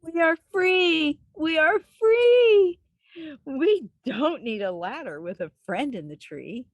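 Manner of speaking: high, loud, slow cry of joy; joyful cry. Acted. Rescued tree dwellers crying out in joy. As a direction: shout it like you're finally free.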